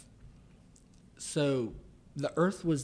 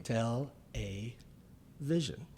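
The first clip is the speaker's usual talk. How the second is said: "Tell a vision" broken into separate words with pauses; really slow; slower than usual. Shown as a demonstration. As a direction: slowly split it as "Tell... a... vision". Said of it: "really slow".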